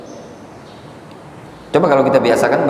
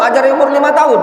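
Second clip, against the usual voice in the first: mocking retort; 10 semitones higher than usual. Mocking listener's version.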